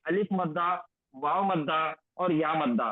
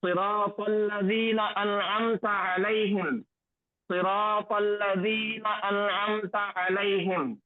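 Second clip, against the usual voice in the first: Quran recitation. Shown as a demonstration. Teacher reciting the verse for students, melodic and slow. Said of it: "ये दो आयतें".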